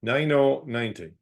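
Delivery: calling each number out, then repeating it; sing-song bingo number calls; bingo caller. Acted.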